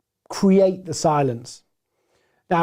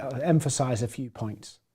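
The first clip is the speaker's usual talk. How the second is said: slowing down; 5 semitones lower, 8 dB quieter than usual. Shown as a demonstration. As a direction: drop low and soft to slow down. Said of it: "slow down to emphasize".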